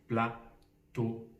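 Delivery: plateau in two separate beats, pla, teau; split into syllables. Shown as a demonstration. Teacher asks how many syllables, "plateau" split in two. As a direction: leave a gap inside "plateau". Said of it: "Combien il a de syllabes".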